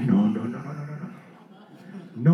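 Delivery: low mock refusal, fading away; a string of low no's trailing into near silence; reluctant refusal. Preacher replaying his refusal to God, reluctant.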